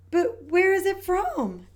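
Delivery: put-on high, curious child voice; puzzled little pig. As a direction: ask it like a puzzled little girl. Peppa asks, puzzled.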